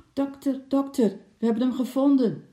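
happy shout between the trees; joyful shout through the woods. A narrator's reading. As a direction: yell it to someone far away, overjoyed. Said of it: "De stem van oom Nijltje klonk tussen de bomen door".

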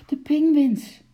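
loud high alarmed cry; calling out. A narrator's reading. Keeper running up to warn uncle, loud alarmed call. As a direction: shout the news across the path. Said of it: "riep Bart".

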